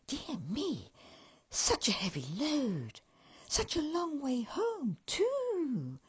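a touch of high elf voice, pitying; cheery elf clucking in pity. Acted.

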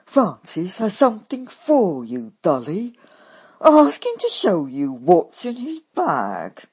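loud, eager urging; can't contain herself; loud excited sister. A narrator's reading. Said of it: "With great eagerness, she says".

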